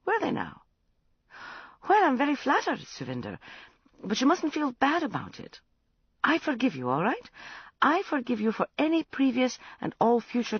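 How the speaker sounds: put-on woman laughing off a drunk confession; amused Catherine. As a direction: humour him grandly. Narrator voices Catherine.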